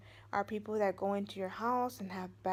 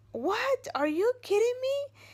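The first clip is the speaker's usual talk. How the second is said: high, loud incredulous "What? Are you kidding me?"; shocked disbelief; 7 dB louder, slower than usual. Retold or imitated. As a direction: replay the jolt of hearing the house was haunted. Retold shock at landlady's news.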